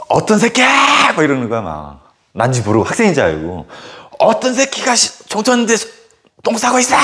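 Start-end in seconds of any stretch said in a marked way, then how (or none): 0.1-1.1 slow furious yell. Retold or imitated. Angry head teacher smells the stall. 4.2-7.0 angry high yell. Retold or imitated. Head teacher scolding the hidden culprit.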